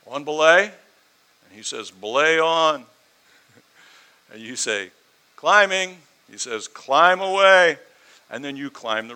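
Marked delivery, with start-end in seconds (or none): 0.1-0.6 loud questioning climber's call. Acted. Acted climbing commands called upward. 1.9-2.8 loud, drawn-out confirming call; calling back. Acted. Acted belayer calls back. 5.4-6.0 high, loud climber's call. Acted. Acted climber calls out. 6.7-7.8 high, loud, slow call; calling back. Acted. Acted belayer calls back.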